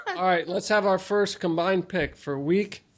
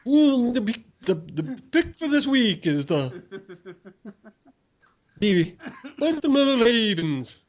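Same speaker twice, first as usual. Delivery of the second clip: bad Spanish accent; 4 semitones higher than usual. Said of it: "That was Dracula".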